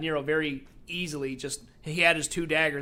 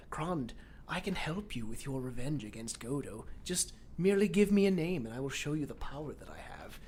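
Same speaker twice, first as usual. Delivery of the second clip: softer, lightly voiced seductive villain; tempting villain; 3 semitones lower, 7 dB quieter than usual. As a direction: quietly tempt him with power. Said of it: "very convincingly going".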